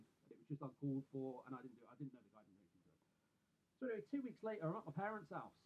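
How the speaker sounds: ordinary delivery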